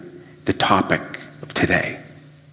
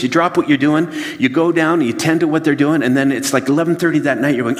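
ordinary delivery

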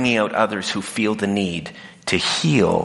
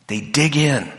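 ordinary talk, nothing marked

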